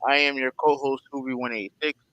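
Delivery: normal talking voice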